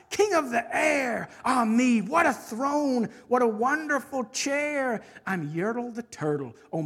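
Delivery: self-adoring royal proclamation; boastful turtle king, delighted. Acted. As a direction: start high and exultant, settle into smug grandeur. Yertle glories in his kingdom.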